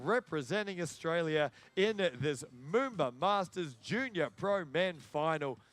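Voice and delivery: ordinary talk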